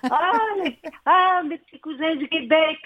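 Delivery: high, hammed-up Parisian French accent; imitating a singer's put-on French accent; exaggerated French accent. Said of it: "elle a pris un accent, euh, français, mais vraiment exagéré".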